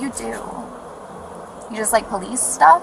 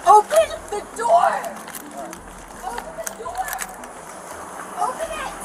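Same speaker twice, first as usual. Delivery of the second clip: panicked; 6 dB quieter than usual. Real, not acted. Woman pleading from the car.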